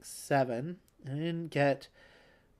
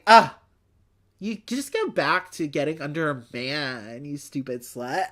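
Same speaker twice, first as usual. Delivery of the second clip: mock-salty paraphrase of the letter writer; 3 semitones lower, 7 dB louder than usual. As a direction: open on a loud scoff, then settle into a lightly jeering put-down. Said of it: "salty. She's saying".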